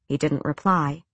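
plain reading voice

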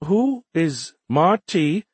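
very slow, high, bewildered alien voice; lost young alien. A narrator's reading. Alien missing the humans' joke, lost and slow. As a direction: sound out the strange name slowly. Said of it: "Nert asked, lost".

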